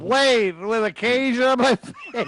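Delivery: high, loud, drawn-out wail; hammed-up freak-out; panicked protest. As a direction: cry out in comic panic, half laughing. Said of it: "I'm really freaking out here".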